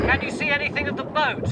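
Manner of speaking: quick tinny radio question; radio comms voice. Real, not acted. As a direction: ask over the radio. Topside asking over radio.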